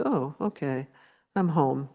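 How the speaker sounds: ordinary delivery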